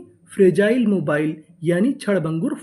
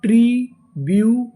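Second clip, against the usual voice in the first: ट्रीब्यूटरीज drawn out, louder; slow syllable by syllable; 3 semitones higher, 4 dB louder, slower than usual. Shown as a demonstration. Teacher sounding out ट्रीब्यूटरीज for the class, slow and loud. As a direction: sound out ट्रीब्यूटरीज slowly, loudly. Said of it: "पूरी meaning".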